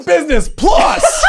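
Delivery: high, loud, drawn-out yell; mock outraged shout. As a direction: holler it like an entitled passenger. Comic mock outburst at agent.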